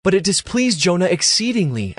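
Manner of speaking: ordinary delivery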